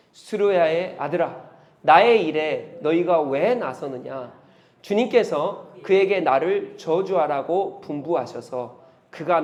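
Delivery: slow, measured congregational reading; scripture read together. Acted. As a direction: read the verse aloud together, steady. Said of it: "다 같이요". (회중이 함께 천천히 읽는 성경 낭독)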